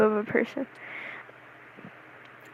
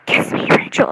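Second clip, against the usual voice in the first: loud, forceful stage whisper; hammed-up whispered begging; intense whisper; 6 dB louder than usual. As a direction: whisper it hard like a mock plea. Re-enacting the outburst once more.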